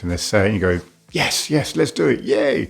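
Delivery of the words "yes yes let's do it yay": high, cheering mock eagerness; acted enthusiasm. Partner agreeing to a sex act, played-up enthusiasm. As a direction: cheer it higher, like an eager yes. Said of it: "them being enthusiastic".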